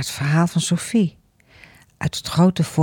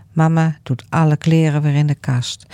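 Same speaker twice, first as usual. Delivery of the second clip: usual speaking voice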